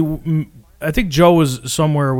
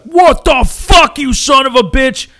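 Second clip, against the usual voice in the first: much higher and louder, drawn-out furious yell; dad yelling at players; 12 semitones higher, 7 dB louder, slower than usual. Retold or imitated. Son retells his dad screaming at jai alai players, slow furious yell. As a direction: bellow it like a gambler who just lost. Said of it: "He'd be like".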